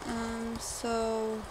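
ordinary delivery

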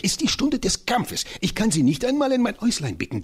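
high, fiery voice of the tiny professor; rally for battle with comic zeal; an excitable old scholar, pitched high; eccentric little professor. Acted. Professor declares battle hour.

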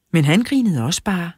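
ordinary delivery

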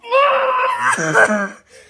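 loud high laughing shout; loud shrieking laugh. Real, not acted. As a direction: let out a big shrieking laugh. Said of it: "lose all my hypers and my laughies".

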